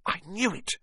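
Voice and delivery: high, softened gasp of realization. A narrator's reading. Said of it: "Hermione gasped".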